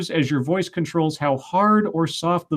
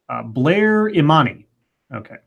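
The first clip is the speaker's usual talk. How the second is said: usual speaking voice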